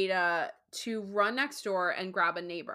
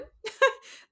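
high laugh; 6 dB louder than usual. Real, not acted. Laughing at the husband.

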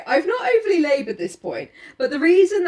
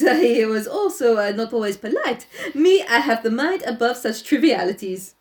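higher, hammed-up haughty detective; lofty Poirot; 4 semitones higher than usual. Poirot brushing off insults, haughty. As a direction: wave it off grandly.

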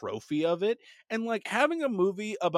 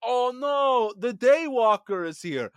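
loud, high mock-panicked vampire; Michael Morbius; 6 semitones higher, 9 dB louder, slower than usual. Podcaster plays vampire fleeing from Blade, loud high Morbius. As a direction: call out as a hammed-up cartoon vampire, building louder. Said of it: "Michael Morbius is like".

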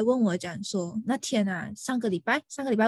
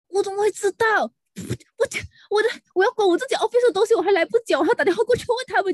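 much higher and louder, fast near the end; exasperated rant; 10 semitones higher, 6 dB louder than usual. Real, not acted. Venting to friends about a pushy client, an exasperated loud rant. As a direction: let the frustration pour out.

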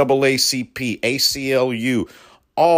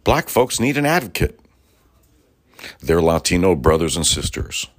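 normal talking voice

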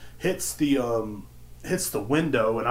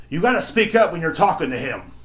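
higher, raised-voice customer; bossy scolding; 5 semitones higher, 4 dB louder than usual. Retold or imitated. Customer scolds the clerk for her deaf father, bossy. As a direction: imitate a customer ordering the clerk about.

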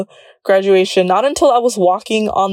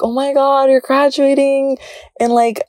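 higher-pitched, mock-excited busybody; imitating nosy people; 5 semitones higher than usual. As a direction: pester them like an overeager well-wisher. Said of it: "people would tell me".

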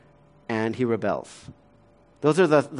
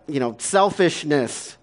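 normal talking voice

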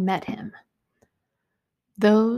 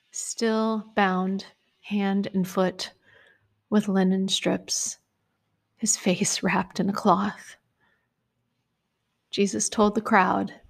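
ordinary talk, nothing marked

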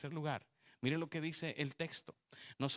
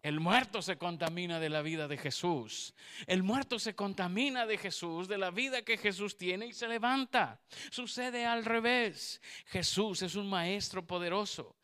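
louder, higher preaching to the women; full-force preaching; 5 semitones higher, 5 dB louder than usual. Acted. Preacher to the women in church, full-force preaching. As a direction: proclaim it from the pulpit.

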